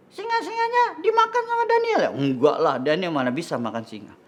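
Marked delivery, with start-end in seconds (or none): 0.1-2.1 very high squeaky puppet voice; childlike puppet. Acted. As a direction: guess eagerly in a tiny high-pitched voice. Puppet guesses the reason.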